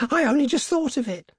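very high, frantic voice; hysterical. A narrator's reading. Wife seized by an idea tells husband, hysterically. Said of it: "she said hysterically".

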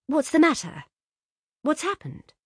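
high, urgent questions; startled by the crowd at the door; alarmed. A narrator's reading. Laura alarmed.

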